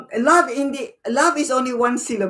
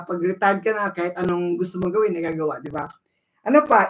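usual speaking voice

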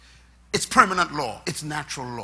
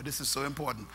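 normal talking voice

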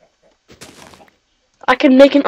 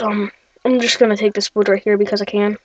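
ordinary talk

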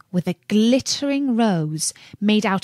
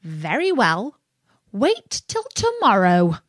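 sly imp voice, veiled threat; mischievous imp promising trouble; giggly sneering imp. Acted. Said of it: "said the imp".

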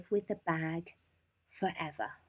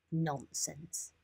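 firm; 3 semitones lower, 8 dB quieter than usual. Acted. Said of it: "said the old lady firmly".

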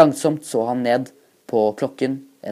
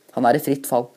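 ordinary delivery, nothing marked

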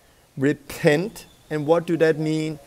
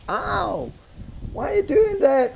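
high, pained ow and protest; hurt complaint; 13 semitones higher than usual. Pretend. Struck man complains to the man who hit him, high and pained. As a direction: yelp like the one who just got hit. Victim hit a second time.

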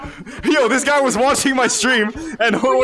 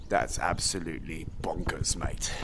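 very low, slow near-whisper; low, soft, drawn-out amazement; hushed disbelief; 13 dB quieter, slower than usual. Pretend. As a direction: murmur it deep under your breath. Two takes of reaction to viewer count.

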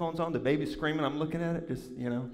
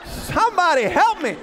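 high, loud mock wail; comic desperate holler; desperate cry for help; 13 semitones higher, 9 dB louder than usual. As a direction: shout for rescue, hammed-up. Acted cry for rescue.

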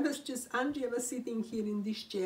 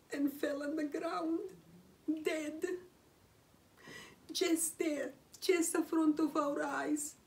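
higher, crying catch in the throat; real tears rising as she tells it; choked-up, trembling with fresh grief; voice breaking in grief; 6 semitones higher than usual. Real, not acted. Cat drops dead, strong emotion.

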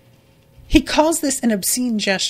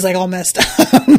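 laughing; 2 semitones higher, 5 dB louder than usual. Real, not acted. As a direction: laugh through the words in disbelief. Laughs at the absurdity.

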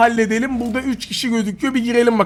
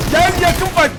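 excited taunt; 9 semitones higher, 4 dB louder, slower than usual. Real, not acted. Taunting enemy in close fight.

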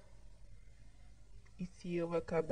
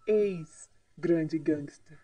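slow teasing; 5 dB louder, much slower than usual. Acted. Teasing correction.

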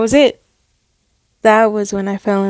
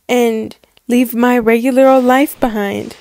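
ordinary talk

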